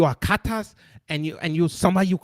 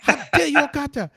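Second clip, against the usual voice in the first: mocking African accent, pitched higher; hammed-up African accent, rising into shouts; comic African accent impression mocking listeners; 7 semitones higher than usual. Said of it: "that is my impression of how they sound".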